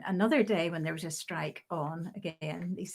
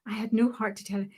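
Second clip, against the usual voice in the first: normal talking voice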